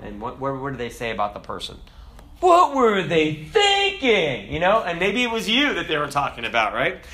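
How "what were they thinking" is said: high, loud, drawn-out disbelief; mock-appalled onlooker, pitched way up; incredulous outcry. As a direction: stretch it out as an exasperated exclamation. Acted reaction to someone doing dumb.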